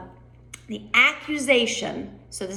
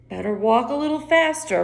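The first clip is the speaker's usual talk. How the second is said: lightly voiced mock turtle; 4 semitones higher than usual. Shown as a demonstration. Teacher voicing mock turtle.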